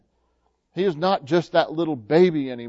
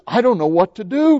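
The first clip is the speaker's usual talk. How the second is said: loud, high, giddy exclamation; overjoyed Scrooge; 7 semitones higher, 7 dB louder than usual. A narrator's reading. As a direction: cry it out, overwhelmed with joy. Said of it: "laughing and crying at the same time".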